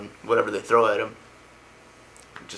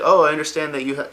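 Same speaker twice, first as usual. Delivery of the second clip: higher, louder quoted voice; mock-polite, pitched up; acted politeness; 6 semitones higher, 7 dB louder than usual. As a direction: imitate an eager conversational opener. Retold speaker being nice to guest.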